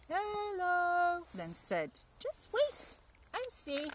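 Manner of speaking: high, sly little mouse; a small clever mouse, scheming. Acted. Mouse tricks the Gruffalo's child, high and sly.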